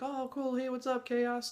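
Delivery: high, soft voice greeting a dog; light, high-pitched hello to a familiar dog; friendly greeting. Retold or imitated. Soldiers saying hi to Chaos the dog, high soft greeting.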